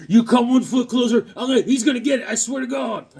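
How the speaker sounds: high, loud hammed-up warning; mock threat. Drawn guy warning someone off, mock panicky threat. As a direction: threaten in a high, excitable cartoon voice.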